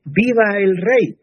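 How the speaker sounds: grito alto y lento, aclamando al rey; aclámalo como la multitud; gritando viva. Retold or imitated. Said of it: "aplaudieron gritando". (la multitud aclama al rey con un grito)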